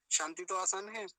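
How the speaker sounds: normal talking voice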